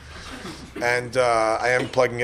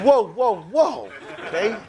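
mock alarm; 4 semitones higher than usual. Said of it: "He's like".

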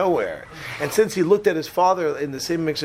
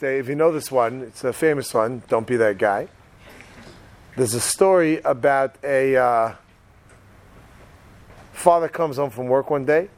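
ordinary talk, nothing marked

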